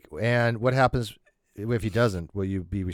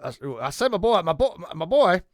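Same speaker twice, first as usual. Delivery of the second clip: Foghorn Leghorn; 6 semitones higher, 5 dB louder than usual. Retold or imitated. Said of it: "That's Foghorn Leghorn".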